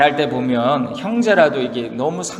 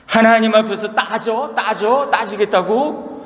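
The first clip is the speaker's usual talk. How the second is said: higher, sneering "따지겠다고?"; mocking echo; 6 semitones higher than usual. Friends mocking Job's wish to argue with God, sneering. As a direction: throw his words back at him in scorn. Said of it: "친구들은 더 열받아가지고".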